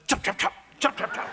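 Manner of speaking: loud, sharp imitated chopping; sudden scissor chops. Barber suddenly cutting the eyebrows, sharp chopping. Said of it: "then all of a sudden".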